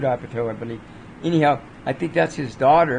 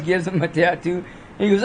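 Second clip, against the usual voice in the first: usual speaking voice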